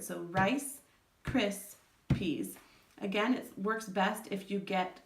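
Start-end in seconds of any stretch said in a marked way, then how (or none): 0.2-2.6 rice, cris, peas said as three separate beats with pauses; syllables broken apart. Shown as a demonstration. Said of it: "tap out those syllables".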